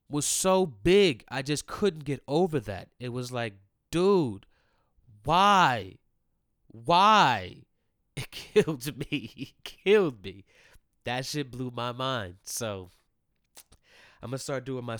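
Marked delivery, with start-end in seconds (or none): 3.9-7.6 1 exasperated outburst. Real, not acted. Stunned by the big man. 7.6-9.3 1 laughing. Real, not acted. Laughing at the memory. 9.4-10.4 1 louder emphatic. Real, not acted. Repeats it with emphasis.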